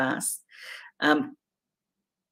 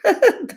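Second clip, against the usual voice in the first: short burst of laughter, much higher and louder; laughing at herself; sharp high laugh; 7 dB louder than usual. Real, not acted. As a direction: crack up briefly. Laughs at own warning.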